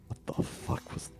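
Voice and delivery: muttered under breath behind a door; low stunned mutter; mumbling. Retold or imitated.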